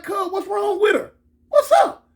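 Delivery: very high, panicked questioning; retold bewildered yelp; acted alarm. Acted alarm at the screaming woman.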